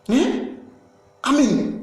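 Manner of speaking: usual speaking voice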